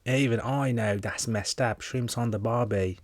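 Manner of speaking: broad, slowed mock Australian accent. Host mocking own bad impression, put-on Australian accent. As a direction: stretch the vowels like a hammed-up Aussie. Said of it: "do Australian".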